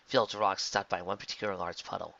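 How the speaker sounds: plain reading voice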